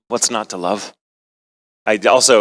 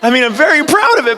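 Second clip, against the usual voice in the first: ordinary talk, nothing marked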